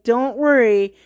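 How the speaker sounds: normal talking voice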